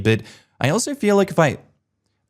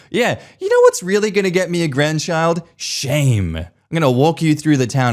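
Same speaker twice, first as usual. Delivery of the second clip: sarcastic mum voice; 6 semitones higher than usual. Acted.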